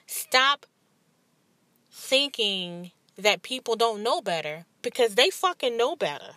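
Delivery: fired-up preaching to her listeners. Real, not acted. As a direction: hammer the point home like a sermon. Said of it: "I feel like I'm preaching".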